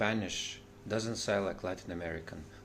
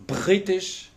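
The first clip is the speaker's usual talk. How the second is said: ordinary talk, nothing marked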